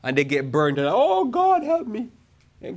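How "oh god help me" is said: high-pitched, drawn-out mock wail to God; mock desperate plea. Burnt advice-ignorer begs God for help, mock desperate. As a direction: imitate a helpless cry for rescue, much higher.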